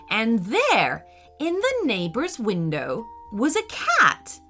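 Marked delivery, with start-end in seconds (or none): none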